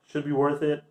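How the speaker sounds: normal talking voice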